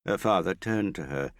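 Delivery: plain reading voice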